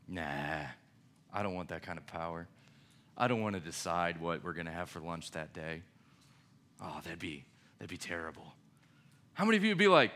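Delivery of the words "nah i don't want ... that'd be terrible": low, soft lazy refusal; mock reluctant student. Student lightly voicing refusal of power, mock-weary. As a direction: play it mock-weary, turning it down.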